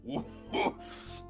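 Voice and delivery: ordinary talk, nothing marked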